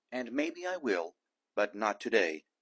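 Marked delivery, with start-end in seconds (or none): none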